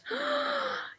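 high sharp gasp of joy; real gasp of joy; delighted gasp. Real, not acted. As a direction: gasp at the toy she hoped for. Gets the toy she wanted.

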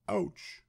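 sudden high ouch; high yelp. A narrator's reading. Splinters hurt Douglas.

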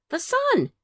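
high little cousin voice; guess. Acted. Cousin Jill guessing the riddle, eager. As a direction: pipe it up as a small child. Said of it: "guessed Cousin Jill".

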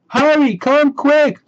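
high, loud panicked call for parents; frightened cry. Acted. Scared sister calling her parents at night, high panicked cry. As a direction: scream for help in the dark. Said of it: "she cried".

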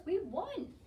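high-pitched victory call; excited shout. A narrator's reading. Boys calling after her, excited high shout. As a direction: announce the win joyfully. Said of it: "shouted the boys".

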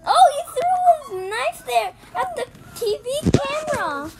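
high, loud excited blurt. Real, not acted. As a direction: react fast, thrilled. Excited reaction to knife throw.

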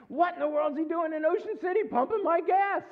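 high-pitched comic bafflement; hammed-up incredulous outcry; mock incredulous. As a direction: exclaim it high in disbelief. Mock bafflement at faraway stranger.

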